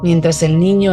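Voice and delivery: usual speaking voice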